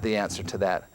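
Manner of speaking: ordinary talk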